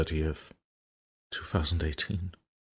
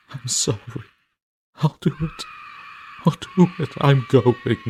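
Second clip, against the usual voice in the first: hysterical surrender; 3 semitones higher, 4 dB louder than usual. Acted. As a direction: break down as you give in. Diarist giving up, hysterical.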